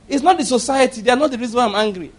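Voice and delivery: ordinary talk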